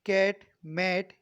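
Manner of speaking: 'कैट, मैट' slowly with a wide open vowel; teacher modelling 'मैट' slowly; open ae vowel demo. Shown as a demonstration. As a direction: stretch the vowel in 'कैट' and 'मैट'. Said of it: "ये बड़ी आवाज़ निकलेगी".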